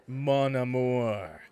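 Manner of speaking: slow purred French Canadian mon amour. Shown as a demonstration. Justin soothing Chad in French Canadian accent, slow purr. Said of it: "his voice went down an octave as he almost purred".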